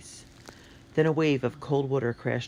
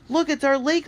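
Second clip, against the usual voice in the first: high, louder delighted cry; girl crying out at the sight of her lake; excited cry; 6 dB louder than usual. Acted. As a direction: point and cry out with joy. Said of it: "Lily cried".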